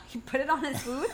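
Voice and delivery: ordinary delivery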